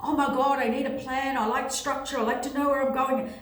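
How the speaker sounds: hammed-up panic; mock panicking. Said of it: "people just panicking, going".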